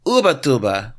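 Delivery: loud "OO-buh-TOO-buh"; pronunciation attempt. Shown as a demonstration. Reader repeating the co-host's Ubatuba, emphatic. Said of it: "they don't say U with the U sound. It's oo".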